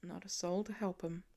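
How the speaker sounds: soft, heavy murmur; thick hushed voice. A narrator's reading. Father grieving for the orphan boy, softly choked. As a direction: keep it low and weighed down. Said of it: "voice thick".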